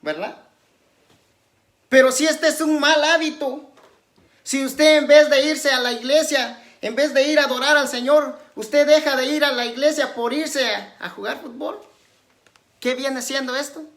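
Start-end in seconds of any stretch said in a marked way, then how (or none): 1.9-13.8 louder, higher, emphatic preaching; prédica enérgica. Real, not acted. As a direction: preach it forcefully to the congregation. Predica con fuerza. (predicando con fuerza)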